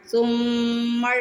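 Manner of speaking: سمر with the م held through the nose; ghunna on meem. Shown as a demonstration. Teacher demonstrating ghunna in a word for students. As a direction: hold the م of سمر nasally, very slowly. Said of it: "یہاں پہ گنہ کرنا ہے میم پہ".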